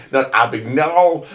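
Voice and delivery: "Abagnale" as "Abag-nahl", loud, high and slow; wrong pronunciation. Shown as a demonstration. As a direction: stretch "Abagnale" into a "-nahl" ending. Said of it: "the way you don't pronounce it".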